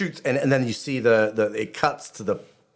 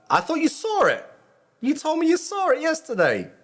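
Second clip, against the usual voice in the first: very high, squeaky indignation; high-pitched protest. Real, not acted. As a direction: protest in a much higher voice. Protests to daughter over spoiler.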